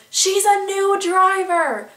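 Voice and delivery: high slow mock yell. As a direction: shout it out the car door, drawn out. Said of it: "she was just yelling".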